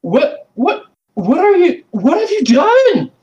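put-on very high, stammering child's voice; boy sees the dead cat, aghast; shocked boy. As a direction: stammer it out in disbelief. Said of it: "jaw just dropped".

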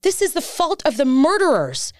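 real anguish and indignation, demanding answers; emotional ranting. Real, not acted. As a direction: plead the case heatedly, hammering key words. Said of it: "I'm sorry I'm ranting".

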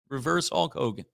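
ordinary talk, nothing marked

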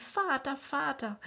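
a high cry of joy; joyful cry. A narrator's reading. Wiseli embraces Andres, calling him father joyfully. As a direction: cry out happily, arms around him. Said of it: "umfasste ihn mit beiden Armen und rief".

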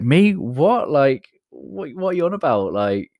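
high, loud disbelief; a startled man protesting he can't possibly; incredulous Moses. Retold or imitated. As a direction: squeak out a stunned refusal. Moses balks at God's call.

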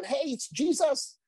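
high, slow eager shout; lightly voiced John; excited call. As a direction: call out the discovery to Peter. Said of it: "said it to Peter".

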